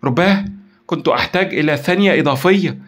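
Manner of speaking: high, loud frustration; distraught exclamation. A narrator's reading. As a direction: lament a near miss with force. Frustrated at losing the call trace.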